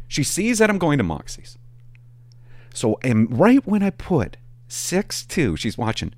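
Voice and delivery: ordinary delivery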